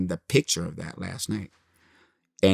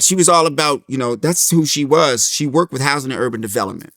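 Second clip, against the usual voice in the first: ordinary talk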